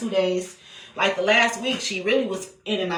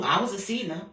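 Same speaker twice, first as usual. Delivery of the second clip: ordinary talk, nothing marked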